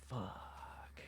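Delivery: breathless whispered fuck; defeated whisper after the joke bombs; whispered curse. Retold or imitated. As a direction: let it slip out under your breath. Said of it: "I was like".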